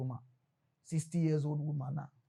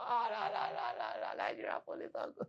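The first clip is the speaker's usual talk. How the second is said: much softer whisper with a giggle; whispered aside; 5 semitones lower, 9 dB quieter than usual. Acted. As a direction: breathe it out under a laugh.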